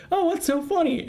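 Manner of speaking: a kindly boss peeking back in; high sweet lady voice. Retold or imitated. Boss returns to the room, high curious sweet voice. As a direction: pitch it way up, polite and curious. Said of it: "she goes".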